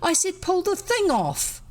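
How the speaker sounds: high, impatient correction; the boy in the pot snapping orders; stern sarcasm. A narrator's reading. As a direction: bite off each word. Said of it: "stern, sarcastic voice".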